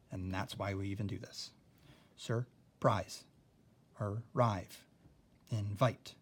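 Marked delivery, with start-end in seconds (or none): 2.2-6.1 "Surprise, arrive, invite" with the second syllable of each punched louder; word by word, stressing "-prise", "-rive", "-vite". Shown as a demonstration. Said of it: "I'm accenting the second syllable".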